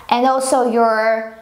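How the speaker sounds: normal talking voice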